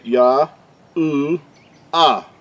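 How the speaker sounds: Yah, U, Ah in three separate pieces with pauses, U as oo; sound by sound. Shown as a demonstration. Teacher showing the accurate way, Yah, U, Ah sounded separately. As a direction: sound out Yah... U... Ah without any W. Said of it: "This is the accurate way".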